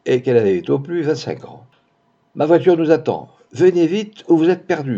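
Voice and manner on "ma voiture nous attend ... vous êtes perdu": higher, louder, pressing plea; urgent warning. A narrator's reading. Blonde stranger urging Max to flee, urgent warning. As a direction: press him to come at once.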